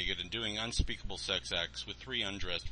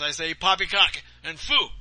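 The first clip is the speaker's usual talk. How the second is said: loud, higher scoffing voice; blustering Santa; 8 semitones higher, 12 dB louder than usual. Acted. Santa dismissing believers, loud blustering scoff. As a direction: scoff grandly.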